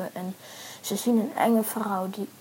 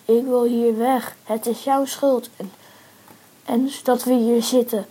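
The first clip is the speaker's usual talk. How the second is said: heated blame; 7 dB louder than usual. A narrator's reading. Characters quarrel while locked in cage.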